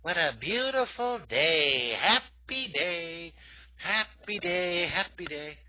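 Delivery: slow, sing-song cheerful flower; daisy character voice. Acted. Teacher voicing the drawn daisy greeting the kids, sing-song. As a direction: voice a happy little cartoon daisy. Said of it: "Our little daisy go".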